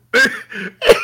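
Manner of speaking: high burst of laughter; bursting out laughing; laughing hard. Real, not acted. Cracking up at own story.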